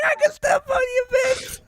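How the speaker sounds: high squealed taunt through laughter; laughing mock taunt. Imagining friend towering over the wrestlers, laughing mock taunt. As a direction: shriek the mock threat while cracking up. Acting out taunt while cracking up.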